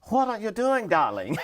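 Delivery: high-pitched grande-dame impression; Zsa Zsa Gabor. Flight attendant imitating startled celebrity passenger, high impression. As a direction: mimic a diva's lilting "darling". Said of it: "she threw off her glasses and looked up at me and says".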